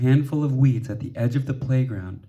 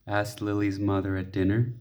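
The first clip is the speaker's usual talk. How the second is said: plain reading voice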